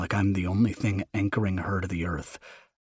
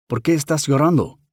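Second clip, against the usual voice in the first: mother voice, Spanish; 8 semitones higher, 7 dB louder than usual. Acted.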